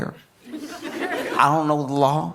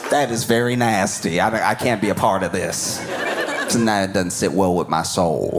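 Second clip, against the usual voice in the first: lower, grave mock lawyer; lower, mock-solemn moral stand; 4 semitones lower than usual. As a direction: lawyer quitting Diddy with mock gravity. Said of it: "you were like".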